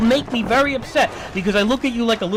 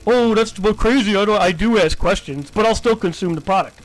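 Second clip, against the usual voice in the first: louder mocking impression of the kid; mock Omar voice; 5 dB louder than usual. Imitating Omar defending consuming the product, loud mockery. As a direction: sneer out his excuse. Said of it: "Oh, consume product".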